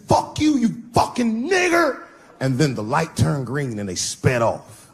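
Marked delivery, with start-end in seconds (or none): 0.1-2.0 high, slowed, taunting yell; slur yelled. Retold or imitated. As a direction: scream the insult from the car. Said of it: "He said".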